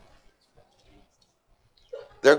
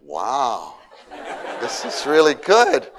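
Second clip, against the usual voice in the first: delighted laughing wow; 6 dB louder, slower than usual. Retold or imitated. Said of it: "I'm like".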